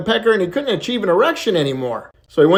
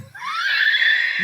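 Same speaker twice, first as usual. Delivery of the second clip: big laugh. Real, not acted. Teller laughs at own punchline.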